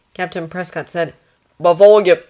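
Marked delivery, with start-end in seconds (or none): 1.6-2.2 very loud Australian patient calling; urgent Australian interjection; Australian accent. Acted. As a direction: call after the doctor in an Australian accent. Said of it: "Australian, that was what the accent".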